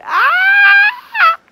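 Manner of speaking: high, loud shriek of excitement; excited scream. Real, not acted. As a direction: let out a sharp thrilled scream. Countdown hits launch moment.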